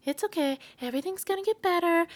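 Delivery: high, sugary fake comfort; lightly mocking reassuring friend; mock yes person. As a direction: coo it like a people pleaser. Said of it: "I'm not gonna be like".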